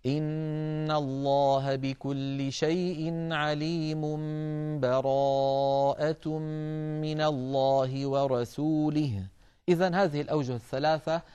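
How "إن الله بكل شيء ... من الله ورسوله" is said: slow chant linking عليم straight into براءة; joined in one breath. Shown as a demonstration. Teacher demonstrating joining two surahs in one breath, slow chant. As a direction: run عليم into براءة without stopping. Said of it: "الوجه الثالث أن نصل فنقول".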